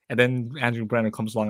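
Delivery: normal talking voice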